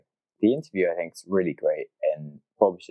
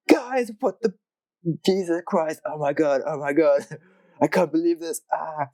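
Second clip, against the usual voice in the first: high, frantic mock panic; freaked-out normal person; 11 semitones higher than usual. Host imagining a normal person finding the mess, high panicked squeal. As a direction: squeal it like someone walking into a wrecked kitchen. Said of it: "any normal human being would have ... been like".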